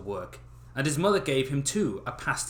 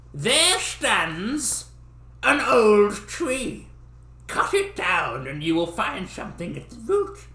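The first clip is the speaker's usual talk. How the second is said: put-on much higher, slow old man blessing; magical old man; 10 semitones higher, 5 dB louder than usual. Little old man rewarding Dummling with a blessing. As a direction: bestow it like a fairy-tale elder.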